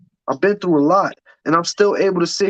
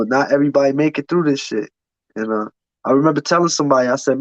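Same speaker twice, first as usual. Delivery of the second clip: normal talking voice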